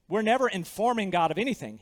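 ordinary delivery

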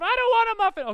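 put-on high, loud whining kid yell; mock tantrum. Kid refusing dad's toast at breakfast, mock tantrum yell. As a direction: imitate a child shouting a refusal.

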